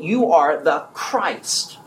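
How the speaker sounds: slow, firm confession; emphatic answer to Jesus. Retold or imitated. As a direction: lean on each word slowly. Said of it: "Said emphatically".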